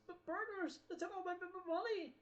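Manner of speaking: high, frantic cry over lost money; panicked scream. Acted. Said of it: "screaming at the top of his lungs".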